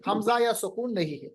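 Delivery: usual speaking voice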